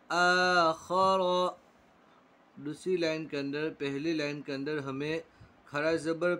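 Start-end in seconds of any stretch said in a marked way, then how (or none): 0.1-1.2 आदम with the first आ held long; slowly drawn out. Shown as a demonstration. Teacher drawing out आदम for the class. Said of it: "खींचकर पढ़ना है".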